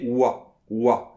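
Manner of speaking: "wa" slow, ou gliding fast into a; wa glide. Shown as a demonstration. Teacher breaking down the wa sound. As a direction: run ou and a together as "wa". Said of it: "Si vous faites les deux très rapidement".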